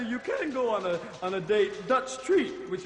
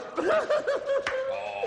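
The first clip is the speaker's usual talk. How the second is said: high cackle. Acted.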